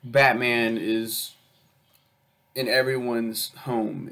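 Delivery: ordinary delivery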